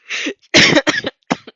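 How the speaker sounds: a sudden loud, high burst of laughter. Real, not acted. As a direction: crack up at your own line.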